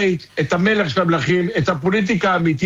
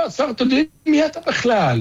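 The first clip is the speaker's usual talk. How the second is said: higher, scornful challenge; dismissive minister; 6 semitones higher than usual. Pretend. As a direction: brush him off like a minister who never heard of him. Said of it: "תגיד לו".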